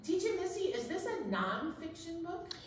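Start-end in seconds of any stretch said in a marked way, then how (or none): none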